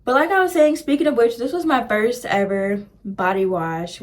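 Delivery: usual speaking voice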